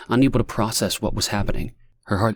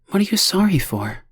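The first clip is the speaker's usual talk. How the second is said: puzzled gentle question; 11 semitones higher than usual. A narrator's reading. Lonnie asking the sobbing stranger, gently puzzled. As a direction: ask gently, confused.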